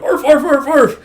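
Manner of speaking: high, loud yapping; imitated dog bark. Voices a dog barking.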